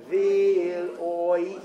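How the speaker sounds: loud Vil oi sliding between vowels; demonstrated diphthong. Shown as a demonstration. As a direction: show the oi gliding as a diphthong. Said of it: "Do they make a diphthong out of it?".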